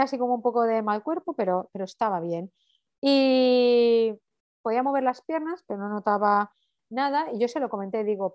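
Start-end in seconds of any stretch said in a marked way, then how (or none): none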